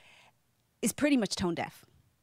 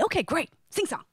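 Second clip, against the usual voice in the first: much higher, bright expectation; gleefully expect the singing; eager anticipation; 9 semitones higher than usual. Retold or imitated. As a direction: bride abroad expecting a sing-song. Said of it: "and I'm thinking".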